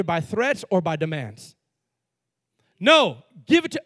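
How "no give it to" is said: sharp, louder, higher demand; mock demand. Bully grabbing the offering, a sharp demand. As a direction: bark it like a bully.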